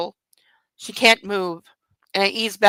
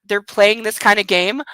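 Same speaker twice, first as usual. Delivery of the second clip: ordinary talk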